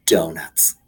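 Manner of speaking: ordinary delivery, nothing marked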